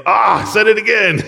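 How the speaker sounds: high, loud "ah" of being caught out; the player who blurted the banned word; mock dismay. Playing charades, caught out.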